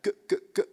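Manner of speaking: short, clicked "G, g, g"; staccato closure. Shown as a demonstration. Coach showing the cords clicking off the attack, "G, g, g" in crisp staccato. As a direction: click the cords together on each "G, g, g". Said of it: "feel a nice staccato closure".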